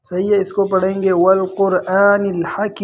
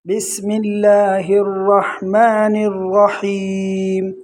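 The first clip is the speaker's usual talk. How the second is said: slow melodic tarannum; Quranic recitation; much slower than usual. Shown as a demonstration. Teacher recites with students in tarannum. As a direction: chant it slowly so students follow.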